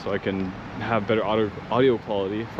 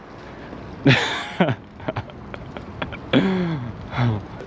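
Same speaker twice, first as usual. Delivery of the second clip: breathy giggle; 5 semitones higher than usual. Real, not acted. Giddy at free charging.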